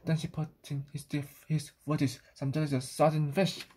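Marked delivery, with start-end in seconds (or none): none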